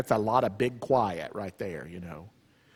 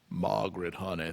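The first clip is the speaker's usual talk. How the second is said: lightly voiced quiet father, lower and softer; father's slow soft drawl; 7 semitones lower, 7 dB quieter than usual. Husband calming his fiery wife, soft and low. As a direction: soothe her gently in his Bardstown way. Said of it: "That's the way he talks".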